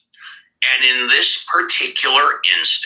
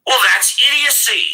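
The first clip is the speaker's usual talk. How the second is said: scornful; 13 semitones higher, faster than usual. Real, not acted. Scornful verdict on caller's reasoning.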